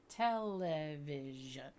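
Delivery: "Television" stretched very slow; syllables drawn out. Shown as a demonstration. Teacher modelling the syllables of a word, very slow. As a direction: chunk out "Television" slowly. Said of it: "and television".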